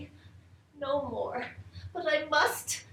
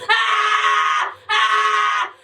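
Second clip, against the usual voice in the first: high loud scream; 8 semitones higher, 6 dB louder than usual. Acted. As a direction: let out a shrill cry of fright. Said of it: "and scream, and scream".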